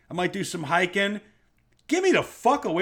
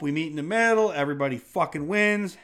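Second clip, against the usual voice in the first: normal talking voice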